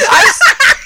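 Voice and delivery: high laughing shout. Real, not acted. Author laughing through the start of her story. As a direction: break off in a loud laugh.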